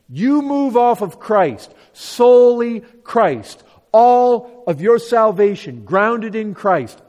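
loud, emphatic preacher, key words drawn out; preaching at full force. Real, not acted. Preacher warning listeners against moving off of Christ, forceful preaching. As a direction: drive the warning home with forceful, stretched stresses.

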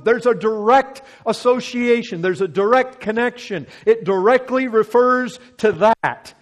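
full force preaching. Acted. Preacher confronting the congregation, at full force. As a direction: preach it hard to the room.

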